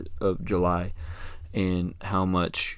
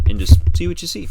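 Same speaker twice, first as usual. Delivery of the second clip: higher, louder and faster urging; excited emphasis; 9 semitones higher, 8 dB louder, faster than usual. Real, not acted. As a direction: push the line up with energy. Repeats phrase for emphasis.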